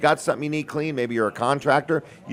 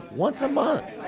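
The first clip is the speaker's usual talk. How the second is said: high-pitched emphatic repeat; 12 semitones higher, slower than usual. Real, not acted. Host pitching free show spots to sponsors, high-pitched emphatic repeat.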